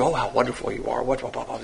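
soft, low mumble of mock praise; mock church gushing. Churchgoers hustling round a newcomer, mock gushing. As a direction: quietly imitate fawning churchgoers, fading into babble. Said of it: "They're all hustling around you".

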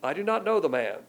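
a hint of Peter swearing up a storm; sworn denial. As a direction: spit out the denial like a curse. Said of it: "he's swearing up a storm".

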